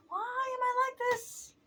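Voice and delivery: high, drawn-out mock lament; mock wail. Mock self-reproach after rambling joke.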